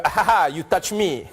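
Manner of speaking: mock Frenchman chuckling in English; French accent. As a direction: banter in a playful French accent. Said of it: "French people just hanging out".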